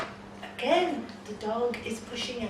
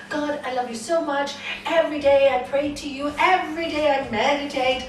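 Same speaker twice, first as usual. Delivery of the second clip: loud, higher, pleading devotee; fervent prayer; 5 semitones higher, 8 dB louder than usual. Acted. Devotee praying to God to appear, fervent. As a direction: plead with God, raised and earnest. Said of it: "used to pray to God".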